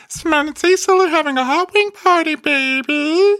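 put-on very high, loud, squeaky child voice; little kid guilty voice. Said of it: "that little kid guilty thing".

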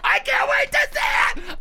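high shrieking fan excitement; mock fangirl squeal.

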